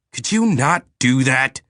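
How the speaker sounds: irritated growl. Acted. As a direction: snap at it to stop. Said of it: "Eric growled".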